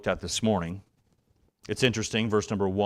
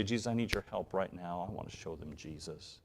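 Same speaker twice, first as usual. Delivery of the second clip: soft, dropping plea to Jesus; quiet prayer; 3 semitones lower, 9 dB quieter than usual. Pretend. Parent quietly asking Jesus for help, soft and low. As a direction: pray it softly, almost to yourself.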